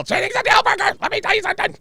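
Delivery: high, loud manic cartoon voice; over-the-top high-pitched comic impression; Fire Marshal Bill. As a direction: screech it as a crazed cartoon character. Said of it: "Fire Marshal Bill".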